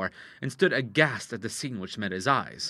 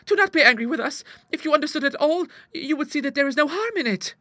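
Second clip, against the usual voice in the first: very high, louder coaxing plea; pleading; 5 dB louder than usual. A narrator's reading. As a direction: win him over sweetly. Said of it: "cried Clara".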